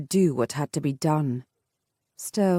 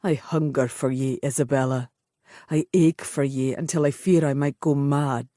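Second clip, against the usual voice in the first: low, intense man's voice full of longing; brooding warrior confessing his hunger; tormented Highland lover; 4 semitones lower than usual. A narrator's reading. Bane's voice in romance.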